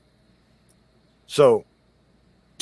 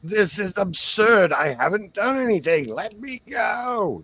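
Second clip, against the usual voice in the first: put-on yelling old man; 10 semitones higher, 4 dB louder than usual. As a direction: yell indignantly as a captured old man. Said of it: "He starts yelling".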